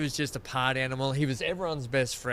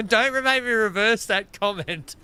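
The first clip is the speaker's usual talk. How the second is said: higher and louder hammed-up warning; mock threat; 9 semitones higher, 9 dB louder than usual. Teasing his gaming mate, loud mock threat. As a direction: ham up the threat, half laughing.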